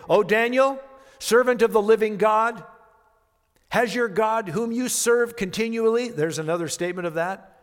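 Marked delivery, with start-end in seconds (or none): 0.1-6.1 high, loud anguished calling out to Daniel; anguished cry. A narrator's reading. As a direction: cry out in desperate fear for his friend. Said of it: "He cried out in a tone of anguish".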